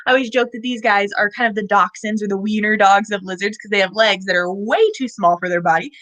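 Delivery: ordinary talk, nothing marked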